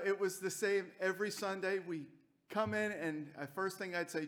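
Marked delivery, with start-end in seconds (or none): none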